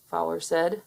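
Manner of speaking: plain reading voice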